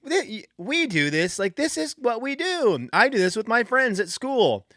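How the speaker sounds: put-on high sugary little-girl voice, slowed on do; sweetheart voice. Dad imitating daughter's sweet school reply, high. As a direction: sweetly explain it like a young daughter. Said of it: "this sweetheart voice".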